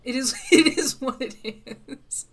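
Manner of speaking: breaking into laughter mid-phrase; laughing. Real, not acted. Streamer admitting a failed French accent to viewers, helpless laughter. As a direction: laugh your way through the words.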